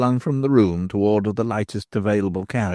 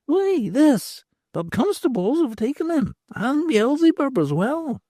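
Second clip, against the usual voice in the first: much higher, cackling crone voice; a knowing old beldam; old hag's voice. Acted.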